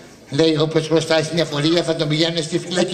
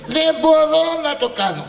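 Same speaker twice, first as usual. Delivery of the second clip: whining complaint; 9 semitones higher than usual. Acted. Frustrated refusal to mourn.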